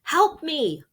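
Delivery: high yelled "Help me!"; desperate cry for help; shout. Acted. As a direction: yell for rescue. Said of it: "I shouted".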